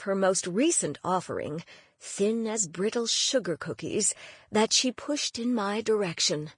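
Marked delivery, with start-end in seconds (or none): none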